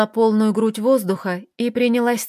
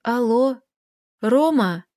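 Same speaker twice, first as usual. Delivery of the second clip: strange voice; 2 semitones higher, slower than usual. A narrator's reading. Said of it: "странным, будто чужим голосом".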